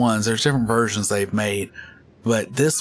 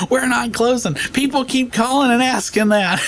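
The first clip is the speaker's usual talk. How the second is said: mad clerk; 5 dB louder than usual. Retold or imitated. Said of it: "And she got so mad".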